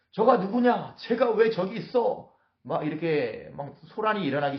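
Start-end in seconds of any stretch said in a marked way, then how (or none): 0.1-2.3 high, loud, indignant surprise; startled outcry. Acted. Philistine lords spotting David in the ranks, startled outcry. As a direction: cry out in acted alarm. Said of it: "그들이 막 놀라기 시작하는".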